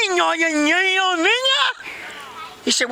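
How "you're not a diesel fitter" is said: very high, garbled protest; indignant Vicky. Acted. Vicky disputes the boss's explanation, high garbled protest. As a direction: mangle the denial in a put-on voice.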